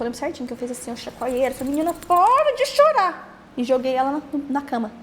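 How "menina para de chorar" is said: high, louder exasperated yell; retold shout at baby. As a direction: snap at the crying baby in desperation. Mother snapping at crying baby.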